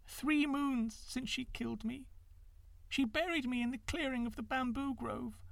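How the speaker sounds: put-on a dead girl's sorrowful farewell; thin, pitiful ghost. A narrator's reading. Ghost daughter tells her father how she died, thin and pitiful. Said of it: "thin and pitiful".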